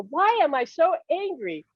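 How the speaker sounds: high-pitched, exasperated self-questioning; retold anger. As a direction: act out sudden angry bewilderment. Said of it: "then I got really angry".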